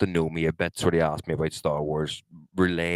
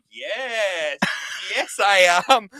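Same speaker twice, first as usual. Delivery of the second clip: high, drawn-out mock affirmation; hammed-up yes broken by a laugh; exaggerated yes; 13 semitones higher, 5 dB louder, much slower than usual. Acting out his body answering the hypnotist, hammed-up.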